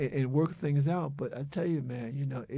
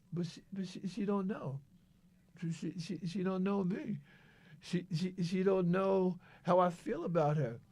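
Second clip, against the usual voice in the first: quiet; 7 dB quieter than usual. Real, not acted. Emotional, voice drops near whisper.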